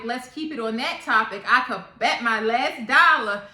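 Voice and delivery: ordinary delivery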